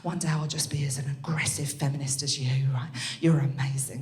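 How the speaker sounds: hushed, breathy adoring whisper; awed whisper. Retold or imitated. Schoolgirl to her teacher, an awed whisper. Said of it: "I was like".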